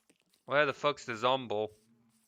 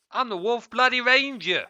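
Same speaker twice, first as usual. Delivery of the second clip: proud boast; 10 semitones higher, 6 dB louder than usual. Real, not acted.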